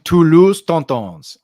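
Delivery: slow, loud put-on French accent. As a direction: announce the team name with a French accent. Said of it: "I don't know why I said that in an accent".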